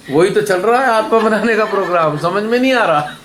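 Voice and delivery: high-pitched, amused retort; mock exasperated retort. Teacher answering the devotee's complaint, amused exasperation. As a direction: answer a complainer as if it were obvious.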